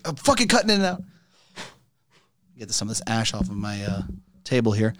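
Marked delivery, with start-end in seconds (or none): 0.0-1.0 higher-pitched imitation of someone's remark; retold outburst. Host retelling what he said, retold outburst. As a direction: quote him in a raised pitch. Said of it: "He's like".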